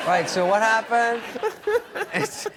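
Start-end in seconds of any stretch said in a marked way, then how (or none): 0.1-1.2 louder, higher, half-attentive question; mock distracted interest. Bachelor to grieving woman while distracted, mock interested questioning. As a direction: feign concern while attention is on the party.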